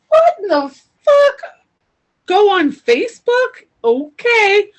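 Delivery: high, loud outraged yelp; shocked disbelief. Retold or imitated. Woman reacting to the voice in her head, loud and high. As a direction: blurt out your shock at the voice.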